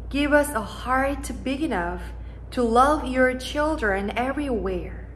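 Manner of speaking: usual speaking voice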